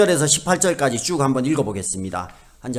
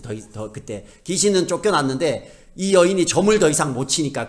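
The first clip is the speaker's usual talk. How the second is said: ordinary talk, nothing marked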